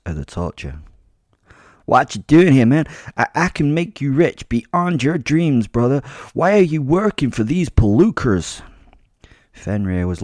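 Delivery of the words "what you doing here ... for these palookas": higher, louder New Jersey gangster, pleading to bargain; bribing New Jersey accent. Acted. New Jersey gangster accent, wheedling bribe. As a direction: gangster tries to bribe the werewolf captor. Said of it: "a thick accent".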